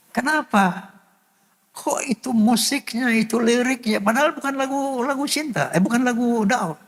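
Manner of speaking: high, tearful bewilderment; overcome, puzzling through tears. Retold or imitated. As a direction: ask why in a high weeping voice. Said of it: "Itu saya nangis gerung-gerungan".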